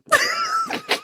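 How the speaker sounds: high squealing giggle fit; can't stop laughing, real; helpless laugh. Real, not acted. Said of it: "I'm laughing so hard I'm crying".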